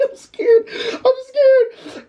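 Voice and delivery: fake-cry it in panic; mock terrified wail. Begging her to take over, mock terrified wail. Retold panic, says he is scared.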